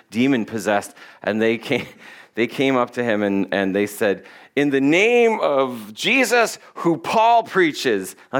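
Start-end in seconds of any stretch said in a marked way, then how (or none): 4.5-8.1 slow, drawn-out proclamation; mock exorcist. Brothers addressing the demon-possessed man, mock solemn. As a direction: intone it slowly like a would-be exorcist.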